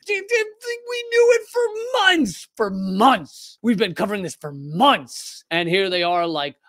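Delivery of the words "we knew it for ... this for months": much higher, slow exasperated yell. Retold or imitated. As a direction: shout at the screen, dragging out "months". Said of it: "you're yelling at your T V screen".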